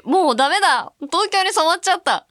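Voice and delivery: loud, high cry of dismay; mock despair. Host lamenting she has gone Tokyo, mock despair. Laments losing her Hakata dialect.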